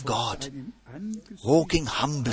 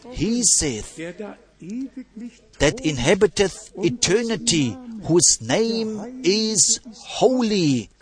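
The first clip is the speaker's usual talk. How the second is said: slow, drawn-out, raised-pitch scripture reading; solemn scripture reading; 5 semitones higher, slower than usual. A narrator's reading. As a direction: stretch out each phrase of the holy verse. Reading scripture aloud to congregation.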